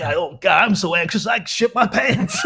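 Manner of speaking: mock cheesy self-help confession; lightly hammed-up anxious speaker, slowing into laughter; cheesy tape voice. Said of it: "they were proper cheesy".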